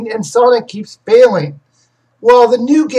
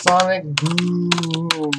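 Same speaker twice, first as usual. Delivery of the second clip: absent-minded sing-out of a search term; slow drawn-out muttering; 2 semitones lower, 6 dB quieter, much slower than usual. Real, not acted. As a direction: draw each word out slowly while typing. Saying search words while typing.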